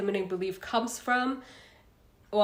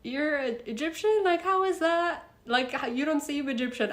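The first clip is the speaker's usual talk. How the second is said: higher, disbelieving, mimicking the locals; incredulous surprise; 7 semitones higher than usual. People in Egypt doubting she's Egyptian, higher incredulous mimicry. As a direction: play the baffled Egyptian who can't believe it. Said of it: "people were like".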